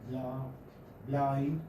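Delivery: "bla" broken off, then the whole "blind"; diphthong in blind. Shown as a demonstration. Teacher showing a diphthong, "bla" then "blind" sounded out. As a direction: build "blind" from "bla", gliding the vowel from ah to ee. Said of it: "You have a diphthong here".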